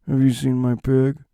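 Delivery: understated movie loner impression; Nick Cage. Man searching for his pig, understated impression. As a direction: deliver it understated, almost without affect. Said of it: "that understated style of delivering his lines".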